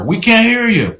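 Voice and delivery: high, mock heckle; imitated New Yorker shout. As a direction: play a New Yorker calling out to the speaker. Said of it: "imagine a New Yorker".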